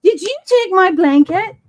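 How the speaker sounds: loud call to the dog. Real, not acted. Calling to the dog.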